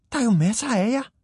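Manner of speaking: high, quick objection; high-pitched, baffled protest; puzzled little hero. Acted. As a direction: pipe up fast in a small character's voice. Hero objects to the lonely crowd.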